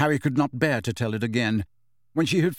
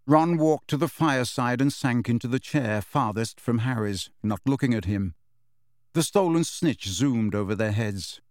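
usual speaking voice

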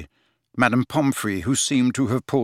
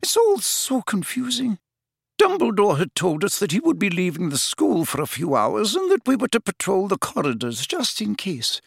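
high-pitched, confused professor; bewildered, agitated older professor; flustered McGonagall; 10 semitones higher than usual. Acted. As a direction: explain it distractedly, pitched well up. Narrator voices flustered McGonagall.

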